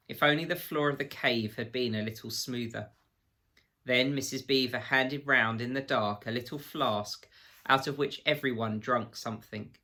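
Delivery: ordinary delivery, nothing marked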